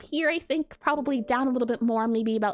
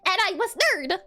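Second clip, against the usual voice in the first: higher, pedantic know-it-all nerd; nerdy Alphys; 3 semitones higher than usual. Shown as a demonstration. Streamer showing off a requested nerd voice, nerdy and pedantic. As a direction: put on a self-important nerd voice, stretching key words. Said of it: "make you do a nerdy Alphys voice".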